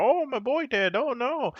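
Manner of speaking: high mock grieving wail. Mocking the movie king's grief, high and hammed-up. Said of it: "And we go".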